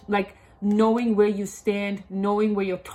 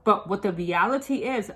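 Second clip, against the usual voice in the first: ordinary delivery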